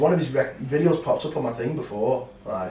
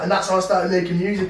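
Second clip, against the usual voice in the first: mock rapper; 8 semitones higher, 7 dB louder than usual. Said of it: "and he's like".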